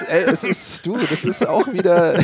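laughing. Real, not acted. Host teasing the guest about his lakeside plot, laughing. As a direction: chuckle through the line at the joke.

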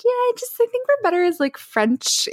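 very high, hesitant let-down; mock-sweet, squeaky excuse; retold awkward brush-off. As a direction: hedge politely in a high little voice. Retells politely turning down suitor.

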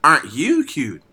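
a hint of talking to a cute child; cooing. Sakura fussing over little Stewie, lightly cooing. Reading Sakura's dialogue aloud.

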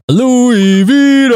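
long drawn-out, high, loud name call; slow, soaring announcer holler; hype announcer shout. Pretend. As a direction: belt out the guest's name like a ring announcer. Calls out guest's name as intro.

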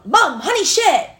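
put-on high, loud little-kid tattle; mock kid reporting to mom; tattling toddler. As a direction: call it out like a toddler telling on her sister. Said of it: "she'll, like, come".

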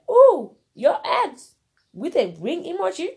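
high, loud, swooping retold disbelief; retold "oh really?" outrage, loud and high; mock incredulous reaction. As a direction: react in exaggerated disbelief, pitch leaping up. Reacting to boyfriend's ex calling.

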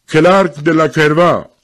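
high, loud, slow roll call; calling out a name. Acted. Official calling the next man, calling out slowly. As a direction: announce the full name slowly to the crowd. Official calls names for draw.

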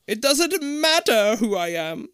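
high, slow, eerie disembodied voice; hollow echoing voice. Acted. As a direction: echo it out like a ghost in the walls. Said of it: "a hollow voice".

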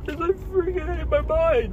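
words squeezed out through tears; crying. Real, not acted. Man on camera breaking down over his mind, sobbing. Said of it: "nobody knows I'm crying".

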